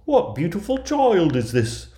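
high, loud cry of wonder; amazed storybook dwarfs exclaiming; astonished dwarfs. A narrator's reading. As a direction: marvel aloud in a high dwarf voice. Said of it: "crying in their astonishment".

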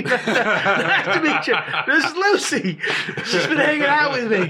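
mock-cheery introduction. Renamed boy introducing Lucy to everybody, mock-cheery, laughing. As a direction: play the eager host, breaking into laughs.

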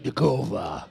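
low, slow Russian Vnukovo; mock Russian take on Vnukovo; Russian accent. Shown as a demonstration. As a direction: growl out Vnukovo like a Russian tough guy. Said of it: "You gotta be like".

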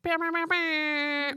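high sung bah bah; mock spooky bah chant. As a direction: chant it like a jingle. Spooky sound effect.

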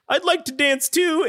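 mock pitiful plea. Man in bean jail asking to dance, in a high plaintive voice. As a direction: sound like a sad left-out prisoner.

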